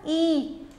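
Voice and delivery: ई held long and level; long sound. Shown as a demonstration. Teacher answering whose sound is heard, ई drawn out. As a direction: sustain ई as one long vowel. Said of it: "long sound".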